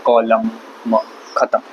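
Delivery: usual speaking voice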